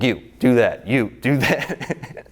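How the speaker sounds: mock ordering people about, stretching into a laugh; cat herder. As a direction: punch out each command like a harried organizer. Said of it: "we call him the cat herder".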